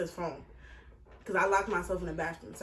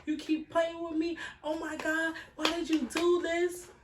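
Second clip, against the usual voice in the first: mother screaming; 9 semitones higher than usual. Retold or imitated. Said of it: "she's screaming".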